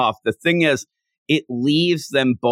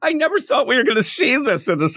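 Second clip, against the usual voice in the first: high-pitched giddy shock; amused disbelief; 11 semitones higher than usual. Real, not acted. Host to co-host on an unexpected manga panel, amused disbelief. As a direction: exclaim it high, scandalized and tickled.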